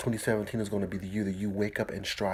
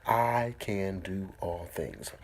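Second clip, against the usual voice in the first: very slow, each word apart; slow affirmation; much slower than usual. Shown as a demonstration. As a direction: lay it down slowly. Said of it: "Get that in your mind".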